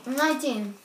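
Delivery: talking through stuffed cheeks; words round a mouthful of marshmallows; mouth full. Real, not acted.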